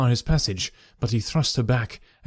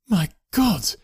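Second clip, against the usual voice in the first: higher gasp of shock; shocked cry; 7 semitones higher than usual. A narrator's reading. Husband seeing the masked child, higher shocked cry. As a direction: recoil in horror. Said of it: "he cried".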